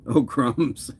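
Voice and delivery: punchline through a laugh. Acted. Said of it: "gingerbread man say".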